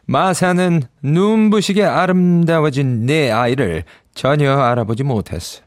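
plain reading voice

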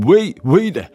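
sudden high startled cry. Acted. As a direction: jump out of your skin. Man startled by sudden water.

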